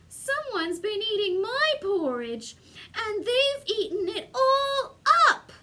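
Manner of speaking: high, wailing cub voice; tearful. A narrator's reading. Baby Bear finding his bowl empty, high tearful wail. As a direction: whine it up to a sob. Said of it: "tears sprang from his eyes".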